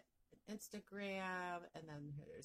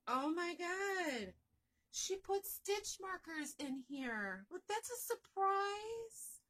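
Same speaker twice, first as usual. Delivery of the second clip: high, slow, loud gasping delight; drawn-out thrilled disbelief; real surprise; 9 semitones higher, 5 dB louder, slower than usual. Real, not acted. Opening surprise gift with stitch markers.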